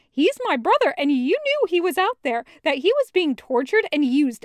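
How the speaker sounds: loud and high, raging accusation; enraged shouting; furious yelling. Acted. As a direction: scream the betrayal at him. Loop yelling at Kravitz.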